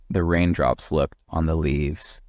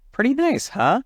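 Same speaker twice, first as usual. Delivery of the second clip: high, friendly pleased question; pleased Norman. Acted. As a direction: invite him to agree.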